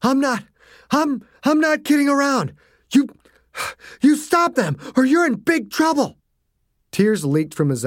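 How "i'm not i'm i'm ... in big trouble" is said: high, strained scolding; father threatening his daughter in panic; panicked threat. A narrator's reading. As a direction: stammer a desperate warning.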